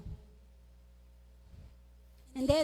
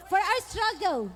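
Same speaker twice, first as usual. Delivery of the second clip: scripture reading; 3 semitones higher than usual. Acted. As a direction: proclaim each line, stretching the key words. Reads Ephesians over music.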